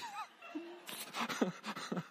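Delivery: high burst of laughter; laughing. Real, not acted. Preacher laughs at his own remark.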